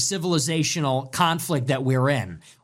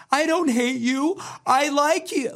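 mocking meek voice; 10 semitones higher than usual. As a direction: sneer it in a limp, pleading falsetto. Mocking a meek singer's lyrics.

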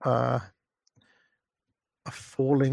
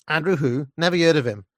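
louder Cockney with dropped aitches; 3 semitones higher, 5 dB louder, faster than usual. Acted. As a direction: mimic a dismissive Cockney girl. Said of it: "that classic blend of Michael Caine Cockney".

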